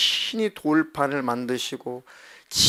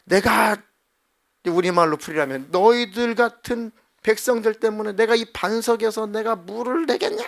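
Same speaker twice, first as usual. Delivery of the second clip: loud, higher furious retort; angry outburst; 7 semitones higher, 3 dB louder than usual. Acted. Moses striking the rock in anger, loud furious retort. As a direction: snap at the stubborn people. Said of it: "모세가 막 너무 화가 나가지고".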